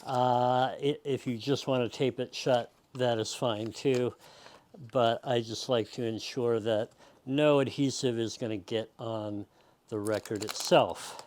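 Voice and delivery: normal talking voice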